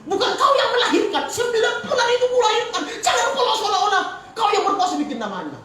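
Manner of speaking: loud, high scolding, half laughing; angry wife. Retold or imitated. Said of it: "ribut istriku". (istri memarahi suami soal nama anak, keras dan tinggi sambil tertawa)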